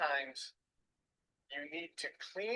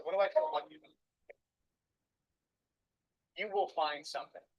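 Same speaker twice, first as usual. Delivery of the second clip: ordinary delivery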